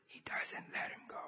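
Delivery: hushed whisper of disbelief. Real, not acted. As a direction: drop to a breathless whisper. Driver refuses to let car merge.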